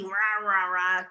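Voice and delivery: growled mock-monster roar; horrible devil voice. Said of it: "una voz horrible así".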